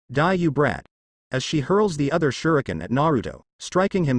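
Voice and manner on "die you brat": plain reading voice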